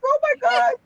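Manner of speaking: high, loud, tearful cry; overcome with tears. Retold or imitated. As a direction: let it break out as you weep. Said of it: "I was like".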